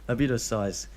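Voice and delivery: ordinary talk, nothing marked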